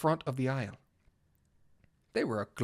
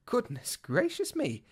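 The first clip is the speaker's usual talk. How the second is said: astonished; 9 semitones higher, slower than usual. Acted. Astonished at magical path.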